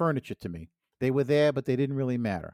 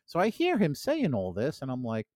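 ordinary talk

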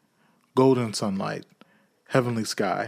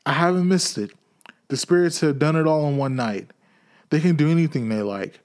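overjoyed; 5 semitones higher, 4 dB louder than usual. Acted. Scrooge overjoyed at the news.

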